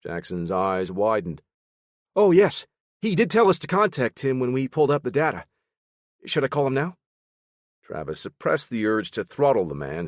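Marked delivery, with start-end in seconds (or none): 2.1-7.0 eager analyst. Acted. Jackson eager to please.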